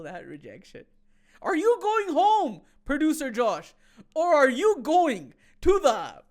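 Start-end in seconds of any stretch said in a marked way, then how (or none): 1.4-6.2 dramatic build-up. Acted.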